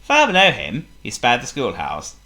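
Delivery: ordinary delivery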